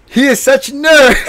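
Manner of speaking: laughing tease. Real, not acted. Teasing his friend while laughing hard. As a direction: say it while you can't stop laughing.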